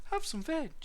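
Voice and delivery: high-pitched welcoming hoot; piping duckbill dinosaur. A narrator's reading. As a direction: hoot a friendly greeting. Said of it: "they hooted".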